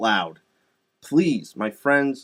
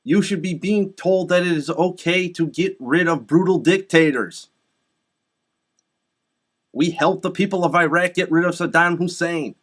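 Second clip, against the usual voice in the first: raised, high, insistent oratory; rally them with a forceful higher voice; impassioned speech; 6 semitones higher than usual. Real, not acted. Addressing people of Iran about brutal dictators, impassioned.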